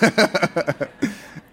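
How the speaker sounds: spontaneous laugh at his own story; real laugh. Real, not acted. Pastor amused by his own late-night call story, laughing. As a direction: break into a real chuckle after the punchline.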